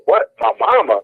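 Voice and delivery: high, incredulous yelp on mama; shocked disbelief. Retold or imitated. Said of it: "So I'm like".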